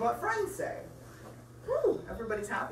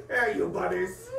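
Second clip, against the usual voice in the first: playful shouted chef voice, high on the last line; hammed-up cook chasing bunnies out; angry chef; 4 dB louder than usual. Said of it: "the chef in the background is like".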